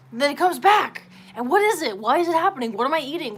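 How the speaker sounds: worked-up, fed-up venting; exasperated. Real, not acted. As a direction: throw up your hands at it. Exasperated rant about diet.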